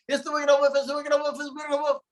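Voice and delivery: high mock scream of recognition. Girl recognizing the wolf, high mock scream. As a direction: shriek it once she knows him. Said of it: "she was screaming louder and louder".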